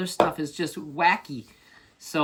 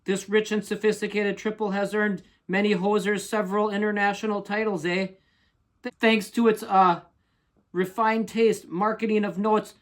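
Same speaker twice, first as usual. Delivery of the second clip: put-on higher-pitched hoser reading in Canadian accent; label read-out; 4 semitones higher than usual. Hoser reads beer label to viewers, raised announcing voice.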